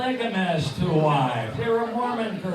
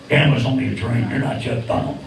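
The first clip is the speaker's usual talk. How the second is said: puppet bird voice; 10 semitones lower than usual. Acted.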